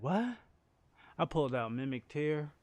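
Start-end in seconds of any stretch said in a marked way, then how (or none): 0.0-0.4 higher and louder startled what; mock shock; surprised. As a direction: jolt of retold surprise. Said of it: "and it's like".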